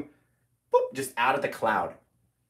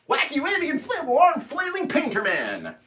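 hyped announcer; 11 semitones higher than usual. Acted. Pitchman catchphrase varied.

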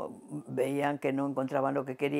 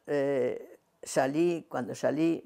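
ordinary talk, nothing marked

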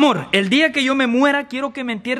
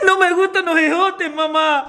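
tearful whine; 2 semitones higher than usual. Acted. Child refuses ejotes to mamá, whining tearfully.

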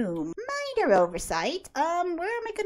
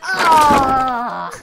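sudden much louder cry of horror; an "ah!" as it all breaks; loud dismayed scream; 11 dB louder than usual. Real, not acted. Player screams as Rowan wrecks the dollhouse, loud cry.

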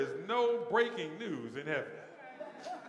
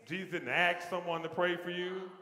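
ordinary talk, nothing marked

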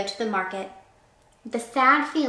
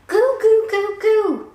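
high, loud hen call; very high, loud farm call; calling at the top of her voice; 11 semitones higher, 8 dB louder, slower than usual. A narrator's reading. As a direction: call the hen home loudly. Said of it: "called at the top of her voice".

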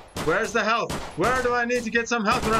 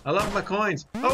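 ordinary talk

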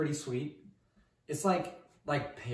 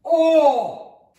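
sudden loud high cry; startled yelp; 13 semitones higher, 14 dB louder than usual. Real, not acted. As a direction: yelp as the fumes hit. Onion fumes hit his nose.

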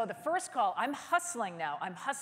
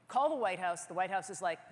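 usual speaking voice

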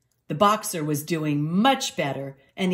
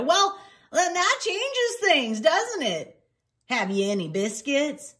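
high, drawn-out change of heart; suddenly interested old clerk; eager beagle innkeeper; 10 semitones higher than usual. Acted.

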